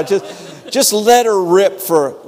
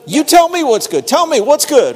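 raised, higher call to the congregation; call and response; 6 semitones higher than usual. Real, not acted. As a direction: demand the answer from the pews. Preacher calls on congregation.

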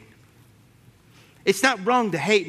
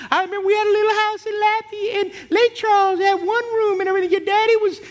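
put-on much higher, louder elderly aunt voice; old aunt reminiscing; 4 dB louder than usual. Aunt telling her nephew about his dad, high-voiced. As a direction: gush the memory like a doting great-aunt. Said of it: "She goes".

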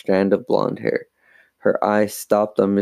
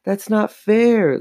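high, drawn-out protest; indignant declaration; 13 semitones higher, 3 dB louder, slower than usual. A narrator's reading. Lindsay objects to Mom's ruling, indignant protest. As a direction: complain slowly in a raised pitch. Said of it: "Lindsay declared".

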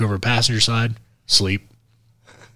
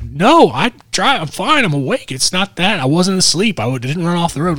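defensive insisting; 6 semitones higher, 3 dB louder than usual. Retold or imitated. Insisting he is fine.

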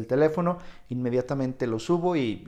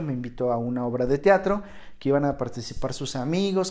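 ordinary delivery

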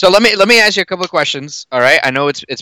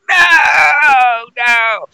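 inner anguished no; 9 semitones higher than usual. Retold or imitated. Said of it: "my inside voice was like".

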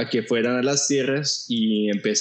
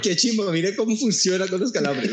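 exaggerated excited voice; 8 semitones higher than usual. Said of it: "Para decir como".

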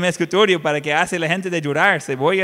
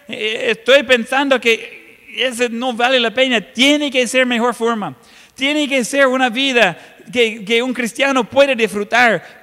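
a hint of a worn-out believer pleading, higher; desperate congregant; 5 semitones higher, 4 dB louder than usual. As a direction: plead like someone at the end of their rope. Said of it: "y la gente dice". (creyente agotado, suplicando)

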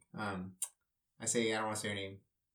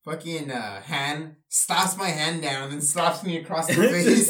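excited retelling; 4 semitones higher, 7 dB louder, slower than usual. Real, not acted. Retelling being slapped.

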